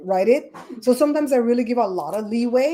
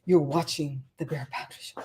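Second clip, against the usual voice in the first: low, softer show-announcer voice; James Spader; 5 semitones lower, 6 dB quieter than usual. Retold or imitated. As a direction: drop low and quiet like a famous actor. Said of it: "James Spader has the voice".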